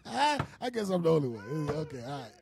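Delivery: normal talking voice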